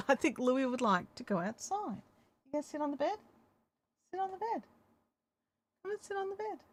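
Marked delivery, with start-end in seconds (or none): 2.4-6.6 much higher sing-song coaxing; high coaxing pet voice. Real, not acted. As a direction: coax the dog up onto the bed sweetly. Coaxing dog onto bed.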